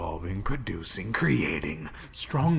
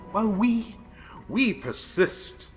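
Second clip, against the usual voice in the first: proud declaration; 11 semitones higher, 4 dB louder than usual. Acted. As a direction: proclaim your immortality. Grand boast.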